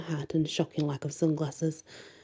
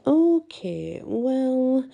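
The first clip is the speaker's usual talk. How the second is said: taken aback; 8 semitones higher, 3 dB louder, much slower than usual. A narrator's reading. Friend reacts to date news.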